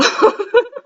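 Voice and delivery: high rising giggle; a quick giggle up into the air. Real, not acted. Can't stop giggling at herself, high giggle. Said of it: "I can't stop giggling".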